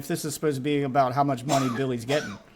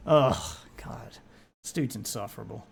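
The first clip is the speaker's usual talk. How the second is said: quiet, low groan of disgust; soft, drained exasperation; weary exasperation; 7 semitones lower, 6 dB quieter than usual. Real, not acted. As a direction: sigh it out under your breath, fed up. Fed up with the video.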